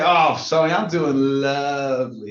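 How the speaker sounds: slow, savored delivery; gushing stock answer. Shown as a demonstration. Agent telling a prospect how he is, gushing. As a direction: linger luxuriously on the last word.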